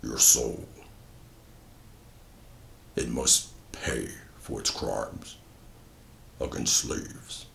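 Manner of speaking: very low, slow, rasping demon voice; monster voice. Acted. The Bawaga speaking to Drummond, deep slow demonic rasp. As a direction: growl it from deep below, slow and whispered. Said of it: "The Bawaga tells him".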